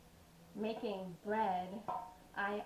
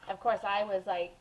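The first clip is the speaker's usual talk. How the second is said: ordinary delivery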